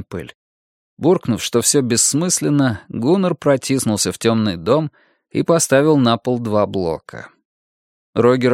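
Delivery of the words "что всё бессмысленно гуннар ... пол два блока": ordinary delivery, nothing marked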